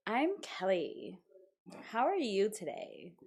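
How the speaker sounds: put-on breathy whispered valley-girl voice, slow on her name; greeting as Kelly in her valley-girl voice. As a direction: play Kelly, soft and airy. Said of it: "that's how Kelly talks".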